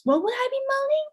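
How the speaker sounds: high, quick baffled question; mock confusion. Date to Kate in foreplay, mock confusion. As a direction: imitate a puzzled date, voice shooting up. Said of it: "Now he gets confused".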